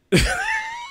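high shrieking laugh. Real, not acted. Laughing after the rant.

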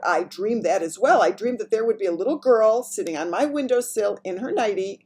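loud, rising outburst; excited cry. A narrator's reading. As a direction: cry it out in amazement. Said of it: "the Queen cried out".

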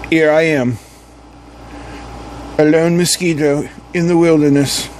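silly mock-British adventurer; British accent. Lone mosquito narrates its wilderness adventure, silly British accent. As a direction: play it with a daft British accent. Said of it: "like, a stupid British accent".

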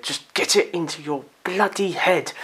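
frustrated outburst. Real, not acted. Frustrated at memorising.

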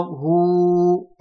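"هو" with the oo held long; long waw vowel. Shown as a demonstration. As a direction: stretch "هو" into a sustained hoo. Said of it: "مع مد الواو". ("هو" بمد الواو)

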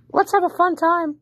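high creepy troll voice; internet troll. Pretend. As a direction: tease them in a silly high voice. Trolling strangers in voice chat.

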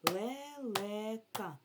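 slow, syllable by syllable, pitch up; slow syllable-by-syllable demo for pupils; split into syllables. Shown as a demonstration. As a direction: break the word into its three beats. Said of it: "поділимо слово на склади".

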